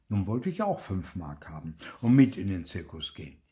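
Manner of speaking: usual speaking voice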